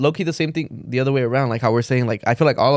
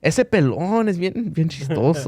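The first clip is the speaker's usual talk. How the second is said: lightly voiced doting tia; a hint of a proud aunt; 4 semitones higher than usual. Mimicking tias praising him.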